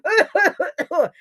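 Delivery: loud, high acted coughing; coughing fit. A narrator's reading. Raccoon has soot in his lungs, coughing hard. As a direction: cough repeatedly as if choking on soot. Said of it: "he was coughing".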